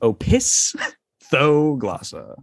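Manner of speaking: Opisthoglossa stretched out very slowly; very slow pronunciation. Shown as a demonstration. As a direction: drag out every syllable of Opisthoglossa. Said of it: "it's pronounced".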